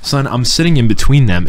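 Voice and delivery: usual speaking voice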